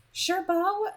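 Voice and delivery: put-on high, puzzled girl's voice; a bright, bewildered reply; confused Taylor. Taylor answering Beau in front of friends, puzzled and high. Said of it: "looking confused".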